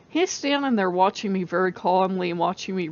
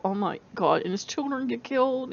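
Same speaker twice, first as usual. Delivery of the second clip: ordinary talk, nothing marked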